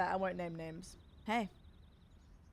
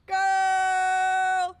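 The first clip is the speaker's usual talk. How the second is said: loud, high, long drawn-out cry; stunned, stretched-out yell; overwhelmed exclamation; 5 semitones higher, 11 dB louder than usual. Real, not acted. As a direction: belt it out in one held wail. Overwhelmed by a big donation.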